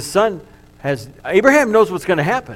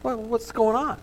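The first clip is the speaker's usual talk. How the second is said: put-on confused boy Isaac; 6 semitones higher, 7 dB quieter than usual. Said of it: "He's like".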